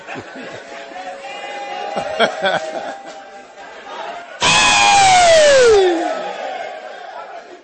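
long loud burst of laughter; loud real laugh; big real laugh. Real, not acted. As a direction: let the laugh roll out. Long laugh after joke.

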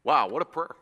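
usual speaking voice

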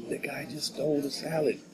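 discreet hushed report. Retold or imitated. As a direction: report it quietly, keeping it discreet. Quoting a coworker's quiet report.

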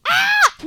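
high, loud gasp into a cry; gasp, then cry out in frustration; frustrated gasp and cry. Real, not acted. Catches herself speaking English.

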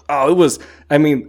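breathless fangirl excitement over a favourite scene; excited gush. Real, not acted. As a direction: gush like a fan who can't hold it in. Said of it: "sorry for the whole noise I'm making".

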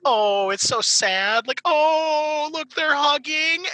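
high, slow, exaggerated sobbing pity; hammed-up mock sadness. Teasing his crying sister with hammed-up sadness. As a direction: overact the sadness to tease her. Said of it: "hamming it up".

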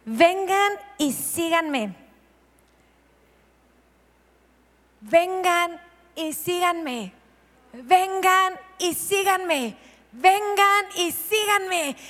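slow invitation building in pitch; slow repeated call. Shown as a demonstration. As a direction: call them slowly, rising each time. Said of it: "repeated slow call".

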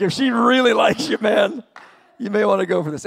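loud, high, pushy buddy voice; urgent advice. Retold or imitated. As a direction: push him hard like an excited pal. Advising son about girlfriend, acted.

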